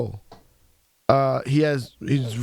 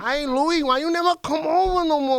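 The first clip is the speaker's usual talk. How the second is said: high mock New York cousin; 9 semitones higher than usual. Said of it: "voicing his cousin".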